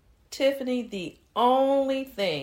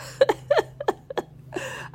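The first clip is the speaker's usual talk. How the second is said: high giggle. Acted. Delighted laugh on the phone.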